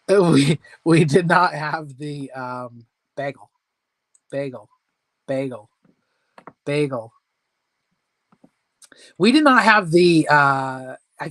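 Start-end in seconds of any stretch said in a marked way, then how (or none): 3.2-7.1 bagel repeated as separate words, each falling; practising bagel. Real, not acted. Host derailed by viewers, practising the word bagel. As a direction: try out "BAY-gul" over and over, pausing between. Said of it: "making me sound out words".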